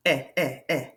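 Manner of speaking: eh repeated in short clipped beats; teacher sounding out a vowel. Shown as a demonstration. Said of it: "listen to the vowel sound".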